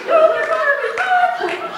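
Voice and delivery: very loud, high shriek; hammed-up horrified cry; acted panicked scream. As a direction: scream it amid the family brawl. Women screaming during the fight.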